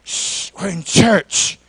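soft, low shushing; hushing. Acted. Churchgoers silencing the shouting man, hushed. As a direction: shush them quietly and disapprovingly. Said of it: "People would say".

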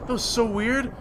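loud, high, shocked exclaim; startled outburst. Real, not acted. Gamer shocked by lag, loud surprised outburst. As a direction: blurt it out in disbelief.

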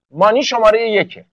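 usual speaking voice

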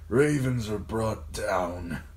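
slow, wispy dying father's voice; thin, pained murmur. A narrator's reading. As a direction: draw it out weakly through pain. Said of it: "thin and wispy and racked by pain".

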